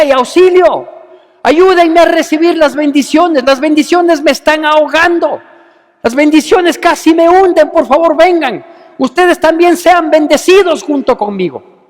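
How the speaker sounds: loud, high hammed-up shout for help; mock cry for help. Peter shouting to the other boat for help, hammed-up loud cry. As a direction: call out as if the boat is sinking.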